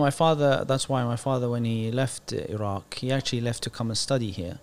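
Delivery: ordinary delivery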